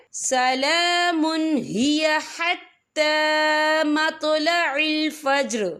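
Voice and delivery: slow, drawn-out tajweed chant; Quran recitation. Shown as a demonstration. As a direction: recite it slowly and melodiously. (धीमी तिलावत)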